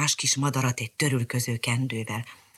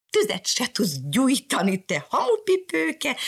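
mock cat jeer; 10 semitones higher, slower than usual. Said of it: "kiáltott rá Mariskára".

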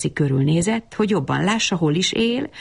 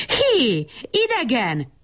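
high, drawn-out hail at contained volume; mock full-throated call; storybook yell; 7 semitones higher, slower than usual. A narrator's reading. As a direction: holler after someone who will not listen, held back in volume. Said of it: "ordította ismét, most már torka szakadtából".

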